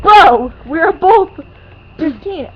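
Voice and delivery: loud, exasperated bro; exasperated retort, loud; exasperated mock retort. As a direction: push back loud and fed up.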